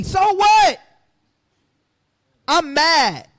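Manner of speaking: high, loud, scornful snap; preacher dismissing celebrity consolation, loud scornful outburst; defiant outburst. Real, not acted. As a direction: throw it back in their faces. Defiant reply to the preached list.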